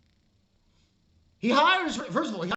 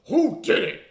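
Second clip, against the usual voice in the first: sudden loud, punchy question; loud retold demand; 8 dB louder than usual. Ranting reviewer retelling the character's question, loudly demanding. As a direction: blurt the question out forcefully.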